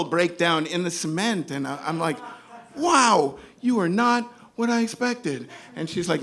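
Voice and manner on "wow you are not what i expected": impressed. Retold or imitated. Impressed driver.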